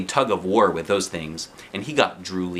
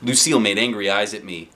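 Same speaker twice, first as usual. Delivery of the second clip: ordinary delivery, nothing marked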